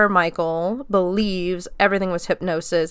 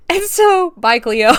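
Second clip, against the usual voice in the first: mock farewell; 10 semitones higher, 8 dB louder than usual. Real, not acted. Mock farewell to bumped segment.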